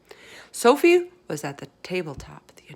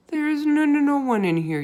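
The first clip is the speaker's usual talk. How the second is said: high, nervous stammer; frightened giant stammering a lie; 9 semitones higher, 4 dB louder than usual. A narrator's reading. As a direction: stumble over it in fear. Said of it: "he stammered".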